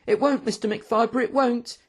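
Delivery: put-on high-pitched, pleading young waiter; insistent waiter. A narrator's reading. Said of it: "insisted Hectus".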